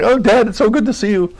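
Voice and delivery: high, delighted hello to a lost father; joyful greeting. Retold or imitated. Son meeting his late father in heaven, high delighted greeting. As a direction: greet him as if reunited. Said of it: "that I'd say".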